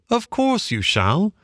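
high, slow, soothing gentleman. A narrator's reading. Gentleman agrees.

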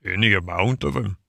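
understated mock sob; a touch of tearful self-pity; sobbing Toad. A narrator's reading. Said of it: "sobbed Toad".

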